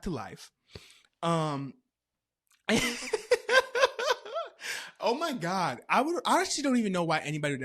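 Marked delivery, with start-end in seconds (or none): 2.7-4.9 long, high, loud cackle; real laugh. Real, not acted. Storyteller cracking up at her jumping story, laughing. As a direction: burst out in helpless high laughter.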